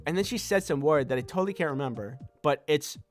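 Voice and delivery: ordinary talk, nothing marked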